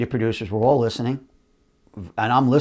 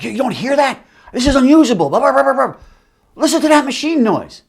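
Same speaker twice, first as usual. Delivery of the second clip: high, loud indignant exec voice; mock outraged exec; 11 semitones higher, 5 dB louder than usual. Executives scolding the audio guy, mock outrage. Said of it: "good impressions".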